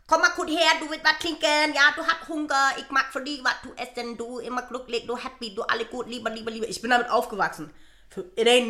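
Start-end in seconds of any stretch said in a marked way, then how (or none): 0.1-6.7 put-on aunt's Thai-accented German; higher-pitched Thai accent, easing down; imitated Thai accent. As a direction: fuss over a niece in a Thai accent. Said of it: "Für mich war es ein thailändischer Akzent".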